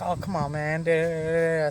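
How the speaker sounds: high, wheedling imitation of the inmate; coaxing taunter. Naked inmate pressing him in the shower, coaxing.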